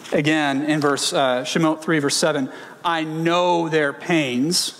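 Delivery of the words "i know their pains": "know" drawn out and rising, very slow; slow emphasis. Shown as a demonstration. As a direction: linger on "know" in "I know their pains". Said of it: "he means this experientially".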